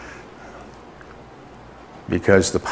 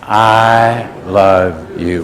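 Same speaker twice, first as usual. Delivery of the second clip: very slow, drawn-out chant; leading in unison; 3 semitones lower, much slower than usual. Real, not acted. Preacher leads a repeat after not hearing the names, slow and drawn out. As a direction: hold each word for the crowd.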